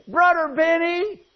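put-on high, childlike little girl voice. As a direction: ask sweetly like a small girl. Little girl quoted in story.

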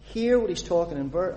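normal talking voice

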